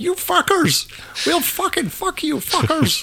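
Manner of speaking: very high, drawn-out fake LA accent; mock LA accent. Playing an outraged LA listener for the co-host, very high mock LA accent. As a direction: screech it high in an over-the-top LA accent. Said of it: "That was my L A accent".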